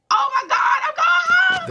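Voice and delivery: high, loud shout of disbelief and joy; overjoyed shout. Retold or imitated. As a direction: shriek it like you just got set free. Hearing her pardon signed.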